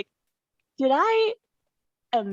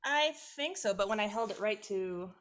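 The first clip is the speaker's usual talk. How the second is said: distant muffled phone voice; speakerphone audio; 11 dB quieter than usual. Real, not acted. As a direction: talk far from the phone. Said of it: "get off a speakerphone".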